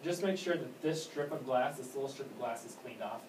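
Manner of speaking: ordinary talk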